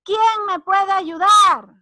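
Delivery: loud, high, slow cry for help; exclamación. Shown as a demonstration. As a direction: call out for help, drawn out. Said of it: "Y la exclamación". (exclamación fuerte, aguda y alargada)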